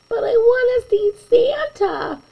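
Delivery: put-on high, whiny little-kid plea; whining child. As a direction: beg like a sulky small boy. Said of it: "Patrick said with a whine".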